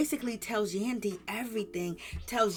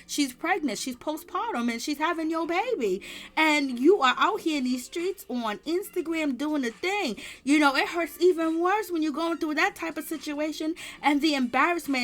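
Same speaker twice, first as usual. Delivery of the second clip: higher, louder scolding rant; indignant; 6 semitones higher, 4 dB louder than usual. Real, not acted. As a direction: let your outrage build.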